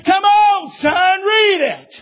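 high, drawn-out bellowed command; bold shout. Retold or imitated. Preacher pressing a boy to read his Bible, bold high shout. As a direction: holler it boldly, stretching the words. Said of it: "And then I really got bold".

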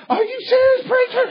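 high startled squeak of disbelief; jaw-dropped shock. Retold or imitated. Frightened inmate asking the preacher, shocked and high-pitched. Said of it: "eyes got up and said".

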